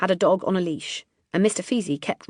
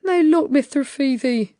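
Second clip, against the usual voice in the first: pleading Larry; 9 semitones higher, 7 dB louder than usual. A narrator's reading. Larry voiced by narrator.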